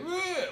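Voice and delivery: high-pitched disgusted "ugh"; mock a wave of nausea; queasy groan. Comic describing his nausea to the crowd, queasy groan.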